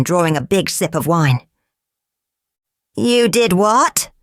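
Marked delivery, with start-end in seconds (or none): 3.0-4.1 high, slow, drawn-out excited question. Acted. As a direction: ask in excited disbelief, pitch climbing at the end. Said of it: "asked the Earl excitedly".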